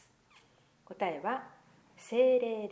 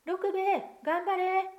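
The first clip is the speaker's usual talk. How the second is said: high, loud cheer down into the pit; shouting; 12 semitones higher, 6 dB louder, slower than usual. Acted. Boy shouting down the hole to the dog, loud cheer. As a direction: call out to urge the dog on. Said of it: "大きな声で叫びました".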